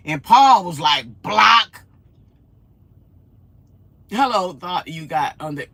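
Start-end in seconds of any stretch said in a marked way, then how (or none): 1.2-5.7 imitated command. Quotes moderator's decisive command.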